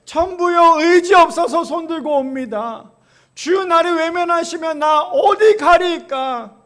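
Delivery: slow, loud, high-pitched crying-out of the hymn words; impassioned hymn recitation. Acted. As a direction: proclaim every word slowly, pleading to God. Recites hymn text forcefully. (천천히 크게 외치듯 찬송 가사 낭독)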